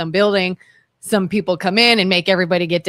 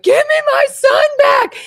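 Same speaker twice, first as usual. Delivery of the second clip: high, louder anguished cry for her son; retold wailing scream; 4 dB louder than usual. Mother recalls her dream, crying out for her son in anguish. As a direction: wail it like a desperate mother. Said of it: "screamed and wailed and was like".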